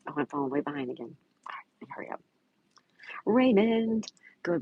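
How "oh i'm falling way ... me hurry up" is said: whispered aside. Real, not acted. Muttering to self, behind schedule.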